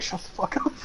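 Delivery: hushed, breathy shut the fuck up under the breath; a secret whisper to himself at the table; whispered inner thought. Retold or imitated. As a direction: hiss it to yourself so nobody hears. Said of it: "I'm like".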